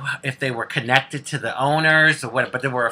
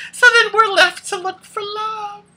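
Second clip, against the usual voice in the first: much higher, sing-song giggly counting; 3 dB louder than usual. Real, not acted. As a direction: count them off high and playful, nearly laughing. Listing lovers, laughing.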